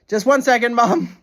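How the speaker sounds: high, loud call to someone in another room. Real, not acted. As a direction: call out to Mum. Calling out to mother.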